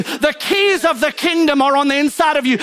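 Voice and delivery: high-pitched, driving sermon delivery; fervent preaching. Real, not acted. Preaches at full force.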